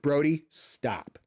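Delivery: drawn-out slow command to the dog; slow, firm telling-off of the dog. Retold or imitated. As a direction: stretch out a firm stop. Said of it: "I'm like".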